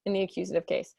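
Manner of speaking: ordinary talk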